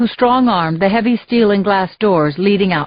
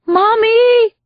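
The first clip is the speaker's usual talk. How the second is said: very high, louder panicked cry; crying out for mommy; 14 semitones higher, 4 dB louder than usual. A narrator's reading. Dazed Yuki in the ER cries out for mommy, very high and loud. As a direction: scream for mommy like a scared child. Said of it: "she yelled".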